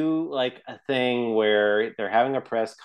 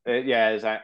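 ordinary delivery

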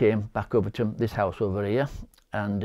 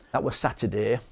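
ordinary delivery

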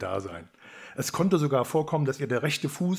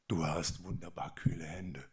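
much softer and lower, hushed wonder; tender, enchanted; 6 semitones lower, 9 dB quieter than usual. A narrator's reading. Young man holding the princess's hand, softly smitten. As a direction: fall in love mid-sentence.

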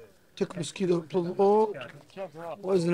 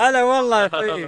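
loud greeting; 4 semitones higher, 8 dB louder than usual. Real, not acted. Warm loud greeting of friend.

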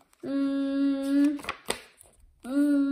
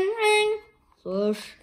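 ordinary delivery, nothing marked

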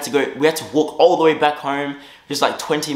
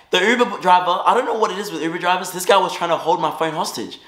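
ordinary delivery

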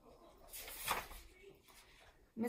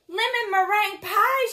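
high, loud storybook outburst; excited character cry, read aloud; delighted exclamation; 9 semitones higher, 10 dB louder than usual. A narrator's reading. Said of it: "she exclaimed".

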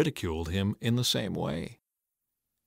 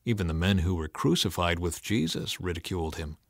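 ordinary delivery

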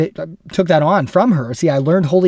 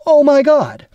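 much higher, louder exasperated cry; 12 semitones higher, 6 dB louder, slower than usual. Shown as a demonstration. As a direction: throw up your hands in frustration. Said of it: "in exasperation".

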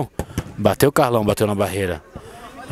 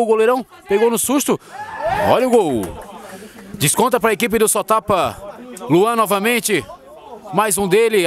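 explosive goal commentary; 9 semitones higher than usual. Real, not acted. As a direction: erupt as the ball hits the net. Second goal, commentator erupts.

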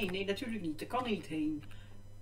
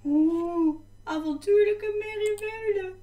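playful put-on voice; 11 semitones higher, 4 dB louder, slower than usual. Playful reaction to a game name.

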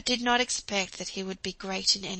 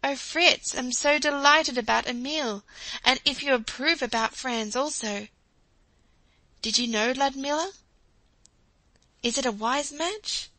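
a hint of an excited wife, higher and louder; lightly voiced eager wife; 5 semitones higher, 4 dB louder than usual. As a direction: ask eagerly about the boys' matches. Jo eagerly asks her husband.

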